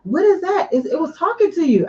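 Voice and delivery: way up high, startled and alarmed. Retold or imitated. Wife imitating Kelly startled by the talking speaker, high alarmed voice. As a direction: jump at a voice from nowhere.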